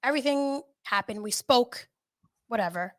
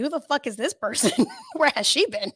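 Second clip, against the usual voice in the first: amused disbelief; 3 semitones higher, 3 dB louder than usual. Retold or imitated. Amazed at her own calm.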